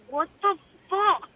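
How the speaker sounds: slow, drawn-out puzzled what; mock the confused onlookers, slow; baffled reaction. Host imitating bewildered family, slow mock surprise.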